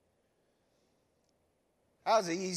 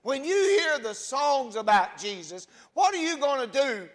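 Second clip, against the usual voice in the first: loud, rising sermon build to the congregation; preaching at full force; 5 semitones higher, 10 dB louder than usual. Acted. Pastor challenging the congregation to respond, full-force preaching. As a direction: pastor pressing the church with rhetorical questions, preaching loud.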